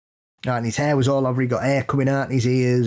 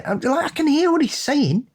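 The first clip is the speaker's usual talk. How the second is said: exasperated disbelief; 13 semitones higher than usual. Real, not acted. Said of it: "exasperated rant".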